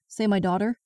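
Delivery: plain reading voice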